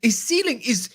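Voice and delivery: usual speaking voice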